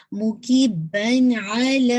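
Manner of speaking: مو کی بن علی very slow, ع clearly from the throat; slow, pronounce the ع in علی distinctly; clear ain. Shown as a demonstration. Teacher showing the student the throat sound. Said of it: "آئین نکال لیجیے گا".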